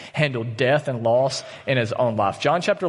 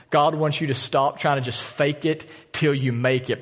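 ordinary talk

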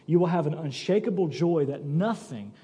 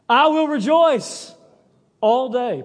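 higher, louder, slow proclamation; triumphant declaration; 6 semitones higher, 5 dB louder, slower than usual. Retold or imitated. Preacher voicing Paul's joy, loud and slow. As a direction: proclaim it like a victory.

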